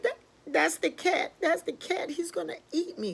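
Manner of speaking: high, stammered alarm; panicking pigeon. Acted. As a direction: cry out as a terrified little bird. Bird hears cat at door.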